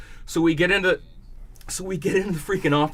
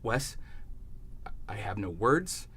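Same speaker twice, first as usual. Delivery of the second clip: shaky; 6 semitones lower, 5 dB quieter than usual. Retold or imitated. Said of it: "He's literally shaking".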